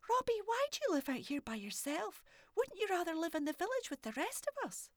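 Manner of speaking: put-on high, bright child's voice; a curious little girl asking boldly; little girl Morag. Morag asking the old man if he's a haggis, high child voice.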